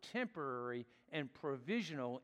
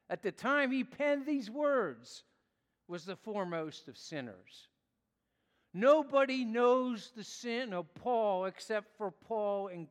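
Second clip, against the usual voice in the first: raised, louder preaching voice; impassioned preaching; 5 semitones higher, 6 dB louder than usual. Acted. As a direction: press the point home to the congregation. Preaching at full force.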